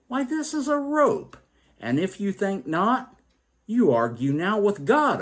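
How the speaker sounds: remorseless sneer. A narrator's reading. Sixth man sneering at the doubters, high sneering voice. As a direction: sneer down at them. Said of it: "sneered without remorse".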